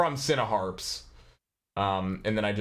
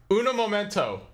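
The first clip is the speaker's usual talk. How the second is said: playful; 10 semitones higher than usual. Acted. Playful aside while waiting on call.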